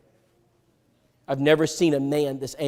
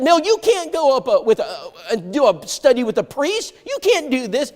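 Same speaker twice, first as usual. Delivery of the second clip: much higher, urgent; alarmed pleading; 8 semitones higher than usual. Retold or imitated. Teen warning his friend off the priest, alarmed. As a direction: plead with him not to go.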